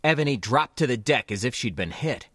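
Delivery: ordinary delivery, nothing marked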